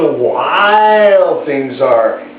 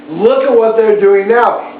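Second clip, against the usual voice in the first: ordinary delivery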